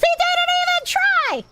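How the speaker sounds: cartoon-high indignant squeal; high squeaky outrage. Streamer mocks a donor's effort in a squeaky voice.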